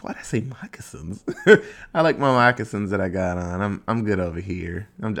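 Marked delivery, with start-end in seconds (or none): 1.3-1.9 breathy laugh. Real, not acted. Laughs at own word choice.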